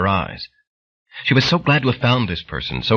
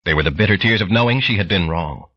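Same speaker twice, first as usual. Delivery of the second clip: ordinary delivery, nothing marked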